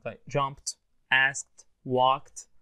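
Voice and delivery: "jumped", "asked", "walked" each ending in a crisp T sound; word-by-word model of "jumped", "asked", "walked" with final T; ED pronounced T. Shown as a demonstration. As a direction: end "jumped", "asked", "walked" on T, no extra syllable. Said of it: "تبرونس T إمتى؟".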